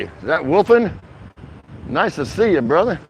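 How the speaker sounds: normal talking voice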